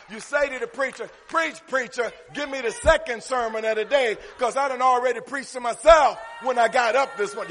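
loud, rising preacher's delivery; full-force preaching. Real, not acted. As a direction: preach it hard to the congregation. Preaching to congregation.

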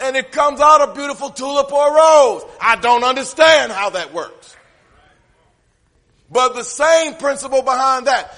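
full-force preaching. Acted. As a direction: drive it home from the pulpit.

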